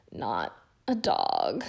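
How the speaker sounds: low, slow pained moan; a low hurt moan, dragged out slowly. A narrator's reading. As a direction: groan the words out through pain. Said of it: "Ron moaned".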